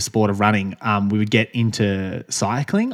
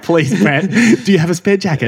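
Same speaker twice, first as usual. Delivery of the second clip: higher-pitched, half-laughing plea; mock pleading; 7 semitones higher, 5 dB louder than usual. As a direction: beg for a favour in playful desperation. Retelling own plea during the tantrum.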